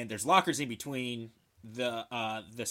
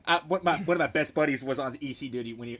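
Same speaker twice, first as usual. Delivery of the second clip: ordinary talk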